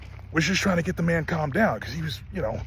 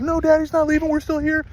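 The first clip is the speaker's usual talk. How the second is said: imitated baby-talk reassurance, very high; high-pitched reassurance; 11 semitones higher than usual. Wife holding upset horse, imitated high reassurance. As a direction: pitch it way up as if soothing a pet.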